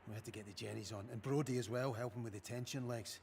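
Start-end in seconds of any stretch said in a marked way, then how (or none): none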